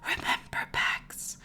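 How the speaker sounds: slow, breathy divine whisper from far away; ghostly whisper. Acted. God whispers a last warning to Bax, fading.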